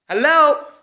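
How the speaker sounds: high, loud hello; calling hello. Acted. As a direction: call out sharply to get his attention. Wife calling for attention.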